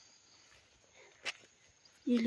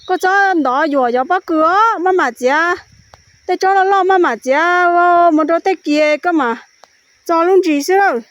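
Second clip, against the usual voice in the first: loud, higher-pitched calling out; real shouting; 5 semitones higher, 16 dB louder than usual. Real, not acted. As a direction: shout it out at full volume. Calling out loudly to someone.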